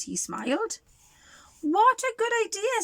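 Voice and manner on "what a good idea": put-on high, bright little girl; eager child voice.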